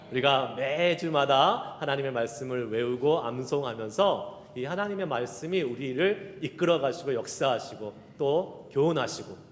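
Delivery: ordinary talk, nothing marked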